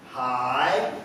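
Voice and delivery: loud, drawn-out polite greeting; overly courteous. Retold or imitated. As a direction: greet the nurse with slow, excessive politeness. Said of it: "uncontrollable urge to be courteous".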